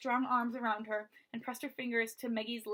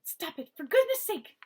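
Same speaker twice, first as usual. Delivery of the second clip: sharp hissed rebuke, rising higher; hissed plea; 7 semitones higher, 8 dB louder than usual. Acted. Eleanor stopping Maggie from struggling, sharp hissed rebuke. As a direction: hiss at the struggling girl. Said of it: "Eleanor hissed".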